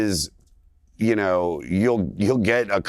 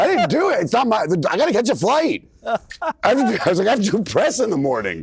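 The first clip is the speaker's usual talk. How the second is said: panicked self; 12 semitones higher than usual. Retold or imitated. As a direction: whine it out like a frantic traveler. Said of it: "And I'm like".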